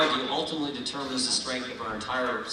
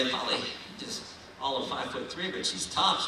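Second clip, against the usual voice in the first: normal talking voice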